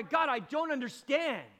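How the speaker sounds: loud, high, pleading; preacher voicing Job pleading with God; heart's cry. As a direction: beg God with full voice. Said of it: "Can you hear his heart's cry".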